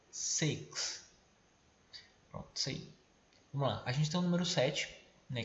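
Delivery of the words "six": whispered six, short vowel; model six. Shown as a demonstration. Teacher asking students to repeat, whispered six. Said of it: "Repete comigo".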